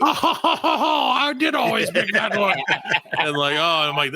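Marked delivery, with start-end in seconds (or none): 0.0-2.6 laughing mock Posh. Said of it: "And she's like".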